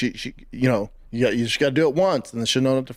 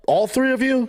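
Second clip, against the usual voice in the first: incredulous dad; 9 semitones higher, slower than usual. Retold or imitated. Dad shocked at son's story.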